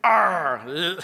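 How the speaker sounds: pirate arr to the audience; hammed-up theater pirate. Shown as a demonstration. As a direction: growl it out front like a stage pirate. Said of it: "you talk like this".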